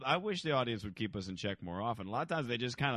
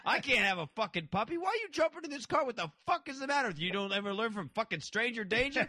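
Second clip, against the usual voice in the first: mock yelling; 11 semitones higher than usual. Prankster berates kid for getting in car, mock yelling. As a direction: imitate an outraged scolding, pitched way up. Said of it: "yelling at the kid for getting in the car".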